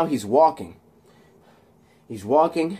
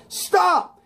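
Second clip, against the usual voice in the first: high, sharp command; imitated officer shouting; acted police shout; 3 dB louder than usual. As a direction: yell an order to halt. Voices police ordering him.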